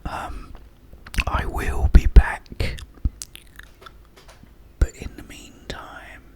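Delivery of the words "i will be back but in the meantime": hushed, unhurried whisper; a gentle whispered farewell. Real, not acted. Sign-off whispered.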